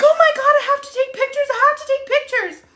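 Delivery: high, fast, squealing panic; thrilled panic about pictures; excited freakout. Retold or imitated. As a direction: squeal it out in a rush. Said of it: "I'm like".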